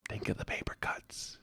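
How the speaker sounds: hushed, voiceless whisper; whispered aside. Real, not acted. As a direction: whisper it in dismay. Mock horror at paper cuts.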